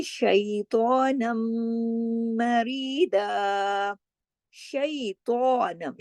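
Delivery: drawn-out chanted reading; slow Quranic recitation. Shown as a demonstration. Teacher recites the phrase for the class. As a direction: recite slowly, each syllable held.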